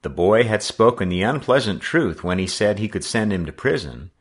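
plain reading voice

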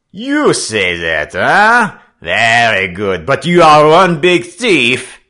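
put-on slow, loud French-accented bullying; angry accusation. French skipper calling the defiant boy a thief, angrily. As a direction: build to a high, loud insult.

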